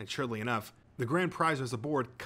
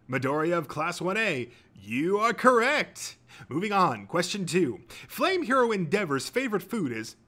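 loud, high, booming show host; quizmaster; 7 semitones higher, 6 dB louder than usual. A narrator's reading.

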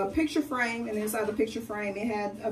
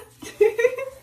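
squealing laugh; 7 dB louder than usual. Real, not acted. Laughs after cheering.

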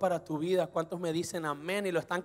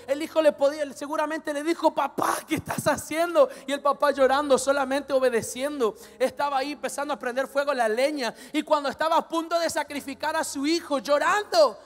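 raised, higher, driving sermon voice; full-force preaching; 7 semitones higher, 9 dB louder than usual. Real, not acted. Preacher telling how Abraham bound his weeping son, impassioned preaching. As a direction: build the story urgently at high volume.

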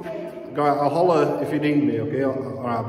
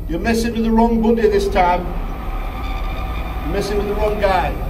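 higher, louder bravado calls; calling into the dark; 11 semitones higher, 3 dB louder than usual. Acted. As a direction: shout brave threats into darkness.

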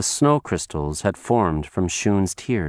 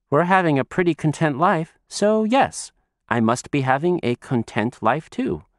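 put-on little girl voice; 6 semitones higher than usual. Child voice.

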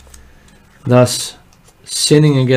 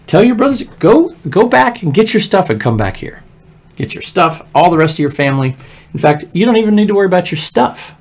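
generous Pharaoh; 7 semitones higher, 4 dB louder than usual. Acted. Pharaoh voiced telling Joseph.

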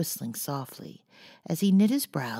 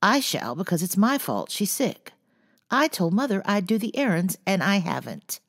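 louder, firm insistence on I; louder resolute claim; decided; 6 semitones higher, 6 dB louder than usual. A narrator's reading. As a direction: insist it is your fault, firmly. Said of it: "said Jo decidedly".

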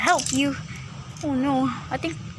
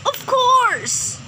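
excited; 10 semitones higher, 4 dB louder than usual. Acted. Eager character agrees.

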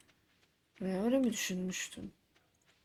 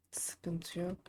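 whispered; 3 semitones lower, 6 dB quieter than usual. Real, not acted. Whispered aside while checking food.

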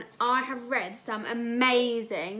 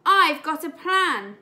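eager announcement; 5 semitones higher, 10 dB louder than usual. Acted. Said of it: "I screamed".

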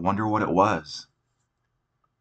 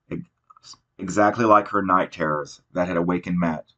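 ordinary delivery, nothing marked

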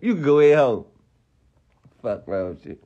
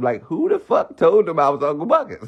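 ordinary talk, nothing marked

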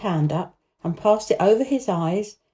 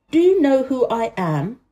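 raised, lordly question; haughty demand; 5 semitones higher, 4 dB louder than usual. A narrator's reading. Boy confronting the staring gardener, haughty demand. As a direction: demand it like a young Rajah. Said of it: "demanded the Rajah".

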